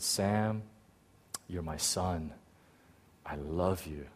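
very soft, low, loving words; tender divine voice. Retold or imitated. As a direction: speak gently as a father to his son. God speaks tenderly to him.

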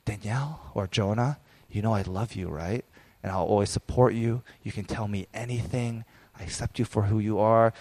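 soft, earnest bedtime words; soft sincere reassurance to a child; tender and sincere to the children. Retold or imitated. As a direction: quietly tell your kids you mean it. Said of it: "I'm very intentful, and I look them in the eye".

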